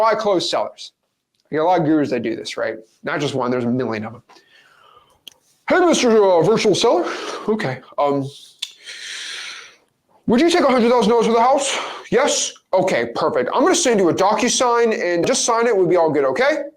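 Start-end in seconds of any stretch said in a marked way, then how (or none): 5.7-16.6 higher-pitched, over-eager fake sales call; mock wholesaling guru. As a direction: parody a guru closing a seller. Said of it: "if I'm a wholesaling guru".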